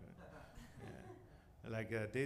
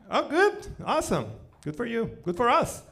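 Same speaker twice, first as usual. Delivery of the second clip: higher, loud mock enthusiasm; sarcastic cheer; 8 semitones higher, 8 dB louder than usual. Real, not acted. Comedian answering the German fan with mock delight. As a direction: fake delight, big and sarcastic.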